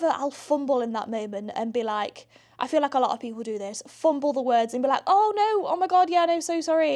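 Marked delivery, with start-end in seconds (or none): 5.1-6.9 higher, louder flustered sorry; fumbling apology. Retold or imitated. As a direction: fumble over the words, apologising. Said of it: "you just go so apologetic".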